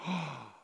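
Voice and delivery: sharp breath, then soft low oh; imitated gasp. Preacher acting out the woman hearing house pets, gasp and soft oh. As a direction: gasp in quiet realization. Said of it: "And she went".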